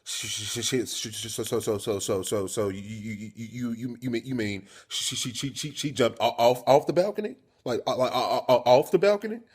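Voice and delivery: hammed-up panicked stutter; scared stuttering. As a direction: stick on each word, then blurt it out in disbelief. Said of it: "When I get scared, I start stuttering".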